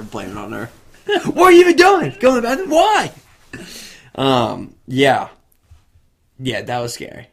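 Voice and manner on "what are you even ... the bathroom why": high, loud mock outrage; mock accusing. Husband blaming his wife in hammed-up outrage. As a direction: gaslight her in a shrill huff. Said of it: "Trying to gaslight her".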